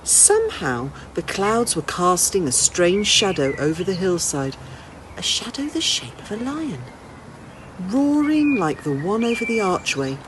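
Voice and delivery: plain reading voice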